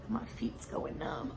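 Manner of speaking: hushed whisper of discomfort. Real, not acted. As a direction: whisper it under your breath. Repeats complaint in whisper.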